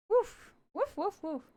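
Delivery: out-of-breath whoosh, repeated; puffing exhales, catching breath; winded puffs. Real, not acted. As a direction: blow out air to recover from squats. Puffing to catch breath after squats.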